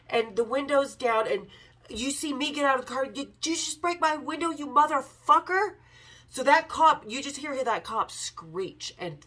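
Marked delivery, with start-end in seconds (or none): 3.1-5.7 higher, louder mock outrage at the window breaker; retold angry yell. Narrator yelling at the frat boy who broke her car window, retold furious shout. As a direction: yell it like she's catching him red-handed.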